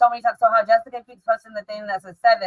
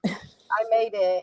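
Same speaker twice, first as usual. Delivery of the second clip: mock creepy voice; 4 dB quieter, much slower than usual. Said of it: "you sound like the".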